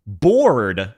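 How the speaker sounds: "Bored" with a strong pronounced R; North American "Bored". Shown as a demonstration. Streamer contrasting accents, North American "Bored" with a hard R. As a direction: stretch "Bored" the Canadian and American way. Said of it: "is very Canadian and American".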